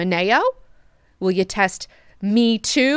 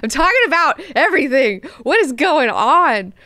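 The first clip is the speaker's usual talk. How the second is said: higher, louder half-laughing rant; mock yelling Duncan; 5 semitones higher, 4 dB louder than usual. Duncan yelling at Leto, higher and louder with a laugh. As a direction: vent at him in exasperation. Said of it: "And he's like".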